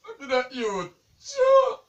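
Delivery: loud, high wailing protest; bawling. Real, not acted. Pranked partner scolding the prankster, bawling. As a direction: bawl it out, upset by the trick. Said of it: "Stop bawling".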